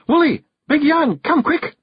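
high, loud Scots call for help; yell. A narrator's reading. Jeanie summons the clan men, urgent yell. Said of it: "she yelled".

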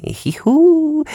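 sudden high-pitched hoot; high squeal. Acted. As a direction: yelp it way up high. Twitching dealer squeals.

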